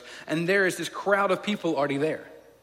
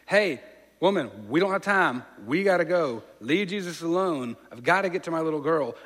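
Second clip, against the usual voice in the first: father frustrated by the woman in his way; impatient father; 3 semitones higher, 6 dB louder than usual. Retold or imitated. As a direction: brush her off in urgent frustration.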